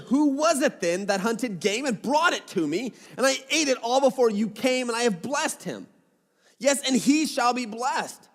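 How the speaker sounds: ordinary talk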